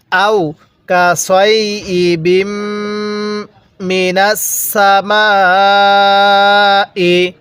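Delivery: slow recitation with a very long aa in "সামাই"; elongated recitation. Shown as a demonstration. Teacher showing how to read, slow drawn-out recitation. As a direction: hold the m of কাসয়িবিম, stretch সামাই. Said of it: "মিমের মধ্যে চার আলিফ টান".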